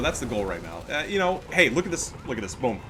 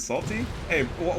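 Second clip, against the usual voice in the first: normal talking voice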